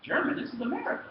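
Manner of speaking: high-pitched disbelief at being handed German; mock incredulous.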